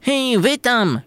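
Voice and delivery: high, slow call. A narrator's reading. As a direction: call across the kitchen. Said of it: "krzyknęła korpulentna patelnia".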